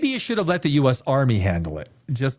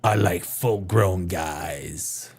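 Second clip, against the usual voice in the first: mock slow declaration; 10 semitones lower, much slower than usual. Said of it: "That's what he should have said".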